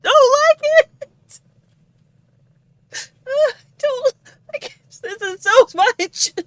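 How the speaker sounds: crying. Real, not acted. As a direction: voice breaking with real tears. Crying at the reveal.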